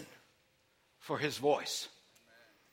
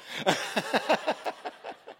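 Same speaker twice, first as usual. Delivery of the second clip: breathy burst of laughter. Real, not acted. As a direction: laugh at your own joke. Laughs after own caller joke.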